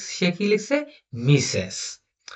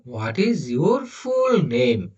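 slow reading; much slower than usual. Shown as a demonstration. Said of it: "মানে".

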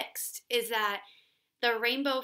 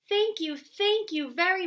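playful little fish, higher and louder; bubbling playfully; 8 semitones higher, 4 dB louder than usual. Acted. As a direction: bubble out thanks like a happy small fish. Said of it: "bubbled playfully".

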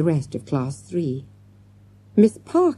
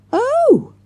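sudden piercing scream, much higher and louder; 7 dB louder than usual. A narrator's reading. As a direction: scream at the crash of glass. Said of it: "screamed Jessica".

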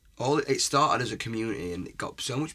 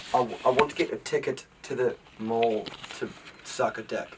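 broad New Zealand accent, put on for a laugh; Kiwi accent; 3 dB louder than usual. Shown as a demonstration. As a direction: slip into a thick Kiwi accent. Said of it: "give us your best Kiwi accent".

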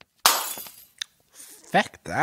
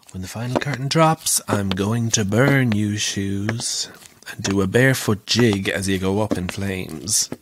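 menacing stage line; 6 semitones lower than usual. Acted. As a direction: growl the curse out like a stage villain. Actor rehearsing villain lines alone.

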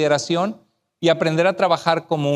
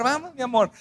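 soft, higher, affectionate call; tender; 6 semitones higher, 4 dB quieter than usual. Retold or imitated. Husband to wife ten minutes after the fight, tender. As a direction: coax her gently, sweetly.

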